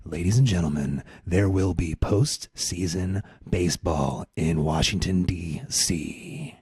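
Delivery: deep, slow announcer gravity; serious voice. Pretend. Host mock-announcing postseason baseball in a deep serious voice. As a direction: intone it low and measured, word by word. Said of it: "do it with my serious voice".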